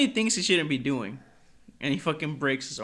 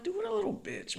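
mock disgust; 5 dB quieter than usual. Friend retelling reaction to Vinny leaving, high jeer. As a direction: squeal the put-down in a high voice. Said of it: "I was like".

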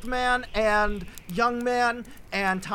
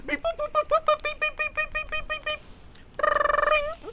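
very high, soft beeping tones; phone dial beeps; 5 dB quieter, slower than usual. Acted. Calling the son on the phone, voicing the high dial beeps.

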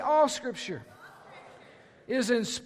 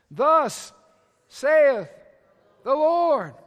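proclaiming loudly; 3 semitones higher, 7 dB louder, much slower than usual. Shown as a demonstration. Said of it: "Y'all know what that phrase is?".

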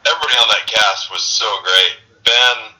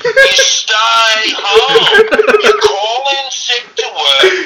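hammed-up Ben lecturing him, pitched way up and dragged out; imitating Ben's rant; 14 semitones higher than usual. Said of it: "And he goes".

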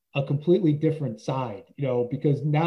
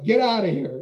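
laughing dismissal; 5 semitones higher than usual. Retold or imitated. Girl laughs off apology.

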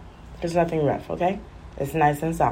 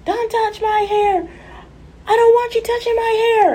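put-on screaming child. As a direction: wail like a toddler guarding his hair. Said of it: "If he sees a comb".

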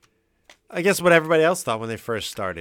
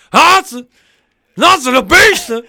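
[name] yell impression; 7 semitones higher, 9 dB louder than usual. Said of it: "I can't get that rasp".

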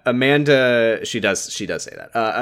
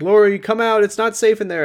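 high-pitched worried call; mock alarm; 10 semitones higher, 4 dB louder than usual. Friends begging Lori to leave the pipe, mock alarm. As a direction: call out urgently, playing it up.

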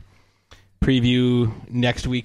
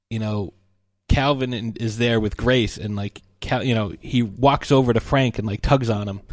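ordinary talk, nothing marked

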